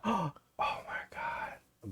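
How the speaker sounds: slow, hushed mock awe; drawn-out stage whisper; awestruck whisper. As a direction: whisper it like a starstruck fan. Acts awestruck at being recognized.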